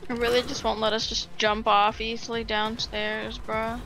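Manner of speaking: normal talking voice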